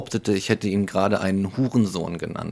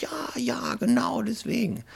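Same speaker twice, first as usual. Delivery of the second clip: high, breathy whispered mockery; mocking high whisper; 3 dB quieter than usual. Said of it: "und die sagt".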